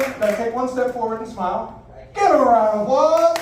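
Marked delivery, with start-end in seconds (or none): 2.1-3.4 loud, high emcee call for applause; rally the whole room to clap; rousing call. Real, not acted. Host calls for applause on stage.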